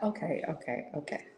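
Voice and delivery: small, soft mock surrender; meek placating. Friend giving in after being told off, meek placating.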